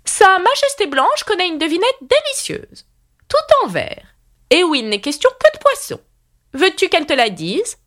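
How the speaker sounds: high-pitched, pompous queen; solemn. A narrator's reading. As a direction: declare it grandly as royalty. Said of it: "très lentement et solennellement". (reine solennelle, voix très haut perchée)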